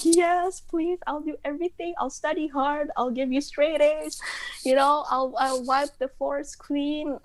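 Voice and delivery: high, eager mock-desperate pleading; dramatic promising. Daughter bargaining with her dad, high and hammed-up. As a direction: promise him anything, overplaying it. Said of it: "I was so dramatic".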